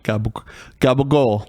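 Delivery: laughing New York Italian accent. Shown as a demonstration. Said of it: "New York Italian".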